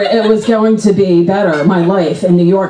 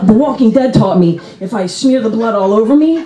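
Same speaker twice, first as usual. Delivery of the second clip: ordinary delivery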